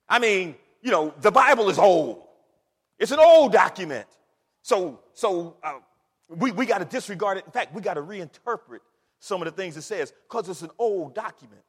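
loud, dismissive bluster; mocked skeptic. Preacher imitating doubters of scripture, loud dismissive protest. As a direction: hammer out the doubter's excuse, leaning hard on OLD. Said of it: "they say".

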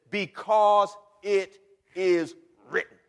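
BECAUSE... IT... IS... WRITTEN, slow and loud; emphatic citation. Acted. Preacher quoting Peter's foundation, slow loud BECAUSE IT IS WRITTEN. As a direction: punch out each word of BECAUSE IT IS WRITTEN. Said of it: "he says".

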